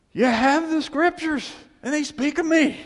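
proclaiming. Retold or imitated. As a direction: thunder it out to the Pharisees. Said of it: "he goes".

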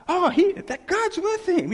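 high-pitched astonished onlooker; sudden high, rushed-out realization, slowed by disbelief; mock amazement. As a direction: gasp it out in exaggerated wonder. Mocks an imagined onlooker's amazement.

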